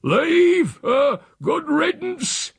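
high, loud, drawn-out scorn; scornful shout. A narrator's reading. Uncle answers the housekeeper's threat to leave, shouting scornfully. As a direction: bellow it back dismissively.

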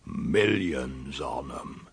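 low, soft, very slow rustic awe; terribly serious. A narrator's reading. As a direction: draw it out with mock solemnity. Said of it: "as if it were all terribly serious".

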